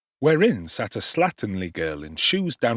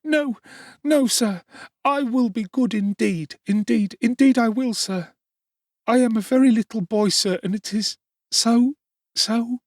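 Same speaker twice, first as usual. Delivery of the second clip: put-on high child's voice begging through tears; tearful pleading; 13 semitones higher, 4 dB louder than usual. A narrator's reading. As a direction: plead like a terrified little boy. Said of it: "sobbed Oliver".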